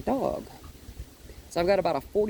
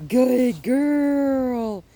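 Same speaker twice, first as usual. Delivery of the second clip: high, loud, long-stretched praise; dog praise; 6 semitones higher, 6 dB louder than usual. Real, not acted. Trainer rewards the stopped dog, high drawn-out praise.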